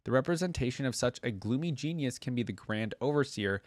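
ordinary talk

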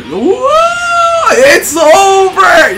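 high, drawn-out triumphant yell; excited shout. Real, not acted. Calling out a winning moment.

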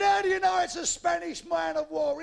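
high, loud shouted question; long-distance bellow; captain's shout. Acted. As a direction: holler up to the crow's nest.

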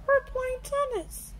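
put-on high little girl apology; Peppa Pig voice. A narrator's reading. Peppa apologises to little brother George, high voice. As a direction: say sorry sweetly. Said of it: "says Peppa".

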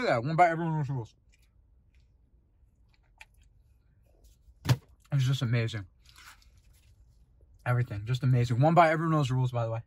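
talking between bites; muffled by food; mouth full, chewing. Real, not acted. Seen 0: bites at -3; chewing-like mouth while talking, holds slice.

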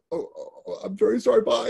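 a tearful, breathless apology before fleeing; choking up. Retold or imitated. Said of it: "And I started tearing up".

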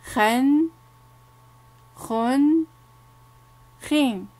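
خن said twice, then خين, each ending in a clear "n" sound; teacher sounding out tanween; tanween demo. Shown as a demonstration. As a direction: finish each syllable on a nasal "n". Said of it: "هيا اقرأوا معي".

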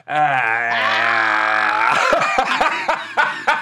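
long, drawn-out mock holler; hammed-up long shout; imitated yell. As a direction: holler "aah" as if yelling out a van door. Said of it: "Lunel goes".

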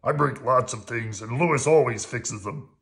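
loud puppet-monster voice; boisterous creature voice; Harry. A narrator's reading. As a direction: chime in heartily as a big monster. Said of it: "said Harry".

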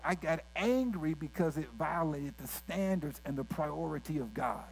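ordinary talk

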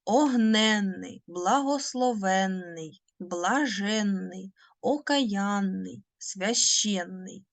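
each word drawn out slowly with the long нн held; slow careful reading of the word list; slow dictation. Shown as a demonstration. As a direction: dictate огненний, благословенний, блаженний, окаянний, священний for pupils to copy. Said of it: "Подивіться".

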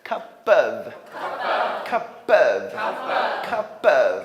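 "cup of" joined as cu-puv; two-beat model "cup of", p carried over; linked pronunciation. Shown as a demonstration. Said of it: "연결돼야 돼".

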